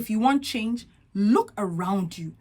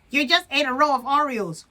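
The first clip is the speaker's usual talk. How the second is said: higher, louder jeer; loud inner critic; 5 semitones higher, 5 dB louder than usual. Acted. Inner critic jeering at the dieter, higher and louder. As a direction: scold like a sneering voice in your head. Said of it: "The inner critic is loud".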